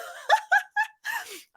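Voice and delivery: high laugh through tears; teary laugh. Real, not acted. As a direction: laugh while still crying with joy. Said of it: "I'm a little bit teary".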